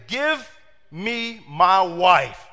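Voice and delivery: very slow, weighty demand; slow insistent give me my wife; serious demand. Retold or imitated. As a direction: drag each word out like a man done waiting. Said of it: "that brother was serious".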